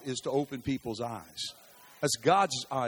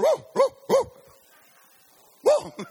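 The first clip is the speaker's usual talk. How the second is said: high, loud "Whoa, whoa, whoa" with a laugh; shouted warning; 10 dB louder, slower than usual. Acted. Preacher shouting a warning to the congregation, loud and high. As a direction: yell it out like a loud alarm. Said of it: "should be going".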